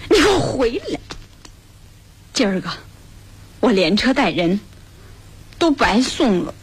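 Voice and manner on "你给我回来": high-pitched, commanding call; calling back sharply. Acted. Woman ordering the departing rickshaw puller back, sharp high call.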